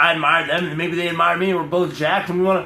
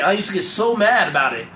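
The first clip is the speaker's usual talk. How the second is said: ordinary delivery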